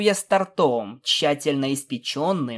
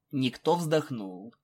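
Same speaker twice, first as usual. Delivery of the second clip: ordinary delivery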